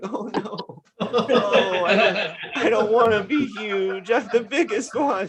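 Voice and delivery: put-on high whiny little girl voice; mock crying third grader; imitating a tall girl close to tears; upset little girl. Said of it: "She's standing in the front like".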